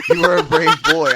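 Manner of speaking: laughing through the line, loud; can't hold the laugh in. Real, not acted. Quoting his girlfriend to his co-host, laughing hard.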